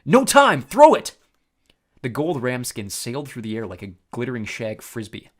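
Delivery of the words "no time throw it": very high, loud urgent shout; yelled. A narrator's reading. Percy yelling to Tyson to throw the fleece. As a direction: call across the field. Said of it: "I yelled".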